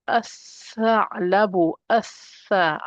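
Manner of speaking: slow as-tha'labu, no L in the article; lam dropped. Shown as a demonstration. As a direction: join A straight to a doubled TH in as-tha'labu. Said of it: "I don't say al".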